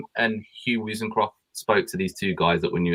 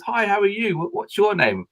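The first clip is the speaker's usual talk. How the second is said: a much higher, bouncy friendly voice; high, cheerful mock greeting; imitating Darren's greeting; 11 semitones higher than usual. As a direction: play the star greeting the nervous newcomers brightly. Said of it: "and he goes".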